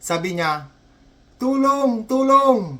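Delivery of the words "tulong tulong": high, drawn-out cry for help; shouting for help. Acted. Accident victim yelling for rescue at the hospital, hammed-up shouting. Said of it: "sigaw ng sigaw".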